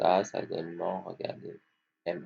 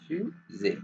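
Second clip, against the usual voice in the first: fusée broken as fu, zée; split into syllables; 6 semitones higher than usual. Shown as a demonstration. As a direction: separate fusée into two clear beats. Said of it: "On va découper le mot maintenant". (« fusée » découpé en syllabes)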